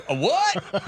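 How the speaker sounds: high-pitched mock-Southern bafflement; baffled Southern reenactor, hammed up; Southern accent. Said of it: "You can do a Southern accent".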